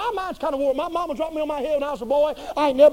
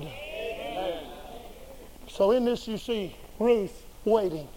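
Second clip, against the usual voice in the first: hushed; 5 semitones lower, 9 dB quieter, much slower than usual. Acted. Slow hushed preacher's pause.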